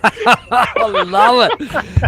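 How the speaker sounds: drawn-out laughing delight, high; real laughter, delighted. Real, not acted. Laughing at the accent attempt.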